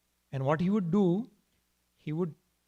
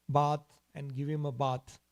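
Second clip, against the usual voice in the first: usual speaking voice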